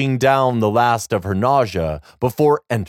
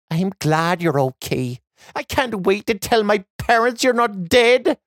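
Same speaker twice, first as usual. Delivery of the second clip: put-on higher, relieved girl; young girl voice; 8 semitones higher than usual. A narrator's reading.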